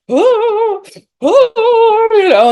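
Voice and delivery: high loud gasps of cold shock; gasping at the cold. Retold or imitated. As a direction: gasp high and loud from icy water. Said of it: "for the whole first minute".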